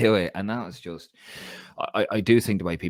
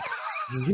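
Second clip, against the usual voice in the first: laugh; 5 semitones higher, 5 dB quieter than usual. Real, not acted. Laughs at own mistake.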